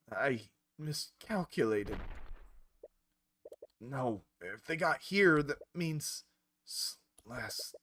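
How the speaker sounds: slow, drowsy words trailing away as a spell takes hold; sinking under a sleep spell, slowing and fading out. Acted. Knight fighting off a sleep spell, drowsy fade. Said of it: "you feel extremely fatigued".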